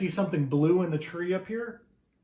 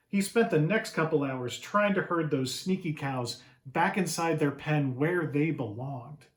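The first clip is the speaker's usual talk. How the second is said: ordinary delivery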